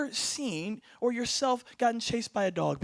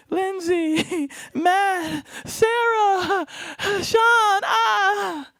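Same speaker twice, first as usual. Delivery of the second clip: higher, drawn-out slow-motion calling; slow motion cry; 7 semitones higher, 5 dB louder, much slower than usual. Pretend. Speaker fleeing in slow motion calls coworkers' names, higher and louder. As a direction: stretch each name like a movie replay. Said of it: "running in slow motion like".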